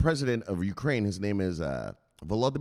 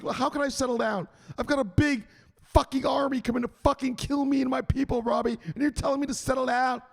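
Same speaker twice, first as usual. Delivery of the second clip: high mock protest; 14 semitones higher than usual. Zelensky protests to Robbie.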